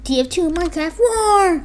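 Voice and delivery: high-pitched exaggerated title read; mock-dramatic high voice; hammed-up title call. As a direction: drag out the last word, up high.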